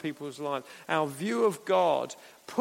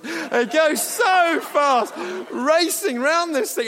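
slow, much higher, louder excited shout; hamster impression; 11 semitones higher, 9 dB louder, slower than usual. Preacher describing racing hamsters, excited high shout. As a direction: stretch it out breathless with glee. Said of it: "This is my hamster impression".

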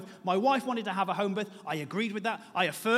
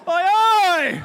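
loud, far-off rowdy chant; lads on tour cheer; 8 semitones higher, 10 dB louder than usual. Retold or imitated. Mocking the midwives as rowdy lads on tour. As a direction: bellow it like a lad on a stag do.

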